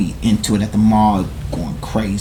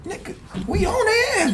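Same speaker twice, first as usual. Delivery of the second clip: high, amped narration; hyped up. Retold or imitated. Telling friends about chasing rivals, amped high voice. Recounting a street clash.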